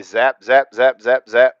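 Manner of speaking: punchy, evenly repeated zaps; mimicked zapping. Streamer showing how the videos got cut, punchy mimicry. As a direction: hit each zap loud like a blast.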